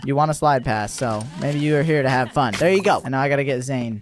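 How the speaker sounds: ordinary talk, nothing marked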